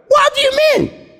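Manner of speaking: sudden shouted disbelief, pitch leaping high; shocked. Retold or imitated. Preacher acting his shock at a listener's question, shouted high. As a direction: blurt it out as if you can't believe it. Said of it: "That's why I'm shocked".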